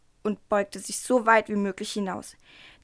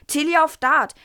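higher, louder outburst of disbelief; shocked exclamation; 6 semitones higher, 7 dB louder than usual. A narrator's reading. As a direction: exclaim it as if unbelievable. Diana's shocked thought in story.

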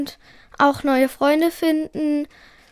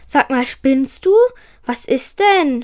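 usual speaking voice

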